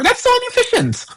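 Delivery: high-pitched mock disbelief; mock incredulity. Mimicking incredulous future generations.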